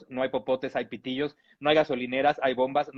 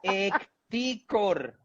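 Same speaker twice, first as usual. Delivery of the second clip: Ecticor, slow and higher, syllable by syllable; drawn-out nickname; 4 semitones higher, much slower than usual. Shown as a demonstration. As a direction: stretch out Ecticor very slowly. Repeats nickname drawn out for effect.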